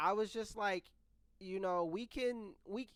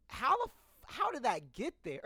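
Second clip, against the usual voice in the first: higher, stunned, cut off mid-curse; shocked disbelief; 8 semitones higher, 3 dB louder than usual. Retold or imitated. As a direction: caught off guard, voice jumping up. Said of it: "And I'm just like".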